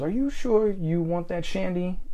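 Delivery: put-on an old German lady's accent, lightly done; a hint of a German accent on an old tour guide. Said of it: "in her German accent".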